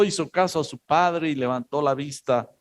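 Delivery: ordinary talk, nothing marked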